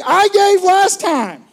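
half-laughing mock outburst, stretched slowly; mock exasperated shout. Shopper pleading at the register.

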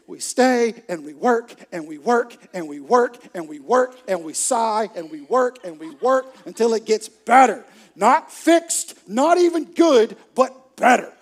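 exaggerated, driving emphasis; relentless comic build. Acts relentless work at the mirror.

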